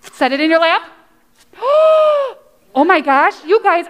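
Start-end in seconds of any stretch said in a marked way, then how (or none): 1.6-2.3 high, loud gasp of delight; big showy intake of amazement; amazed gasp. Pretend. Teacher amazed at children listening, showy gasp.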